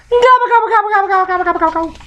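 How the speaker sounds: gobble like a turkey, then trail off. Pretend. Reader mimicking the feast turkey, very high gobble.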